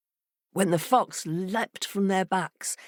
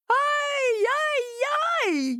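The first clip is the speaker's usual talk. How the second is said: much higher, hammed-up wail; fox wailing; much slower than usual. A narrator's reading. Fox hearing his heart is wanted, mock wail. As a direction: wail it theatrically. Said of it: "wailed the fox".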